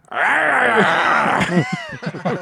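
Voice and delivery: evil laugh attempt. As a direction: overdo a villain laugh. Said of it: "that doesn't sound right".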